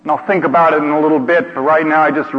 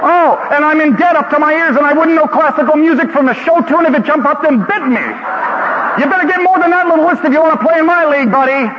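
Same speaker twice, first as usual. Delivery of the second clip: challenging yell; 11 semitones higher than usual. Retold or imitated. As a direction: yell back topping his list. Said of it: "this voice yelling".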